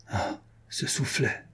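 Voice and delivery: slow, breathless whisper; a slow, hushed murmur with no voice. A narrator's reading. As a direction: whisper it to himself, stung by the memory. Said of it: "murmura-t-il".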